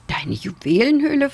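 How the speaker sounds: high, surprised question; astonished exclamation. A narrator's reading. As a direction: exclaim it in mild astonishment, pitch lifted. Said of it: "riefen der Hase und der Bär".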